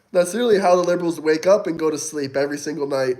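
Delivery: normal talking voice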